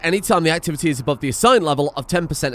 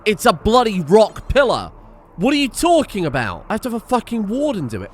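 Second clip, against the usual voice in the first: higher-pitched, indignant exasperation; exasperated rant; 7 semitones higher, 4 dB louder than usual. Real, not acted. Player railing at a rock pillar needing a warden, exasperated. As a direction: protest in disbelief at a stupid rule. Ranting at an absurd game rule.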